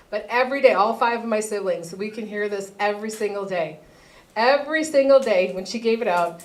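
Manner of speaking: ordinary talk, nothing marked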